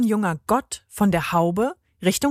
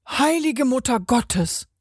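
higher, startled outburst; shocked exclamation; 5 semitones higher than usual. A narrator's reading. Customer sees the biscuit talk, startled exclamation. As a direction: gasp of shock in an altered voice. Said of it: "entfuhr es der Dame".

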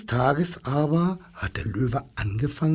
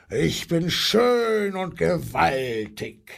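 slow, drawn-out lion voice; brüllender Löwe; 3 semitones higher, slower than usual. A narrator's reading. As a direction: boast in a long, stretched roar. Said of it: "brüllte er". (langgezogen brüllender, stolzer Löwe)

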